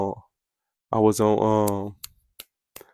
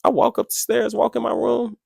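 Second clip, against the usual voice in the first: ordinary talk, nothing marked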